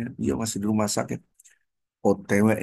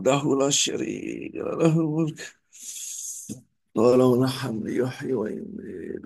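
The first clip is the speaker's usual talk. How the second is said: pained dhikr; 5 semitones higher than usual. Shown as a demonstration. Teacher showing a patient how to be sick, pained dhikr. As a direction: groan out the dhikr in place of cries of pain. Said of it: "saya ajarin cara sakit".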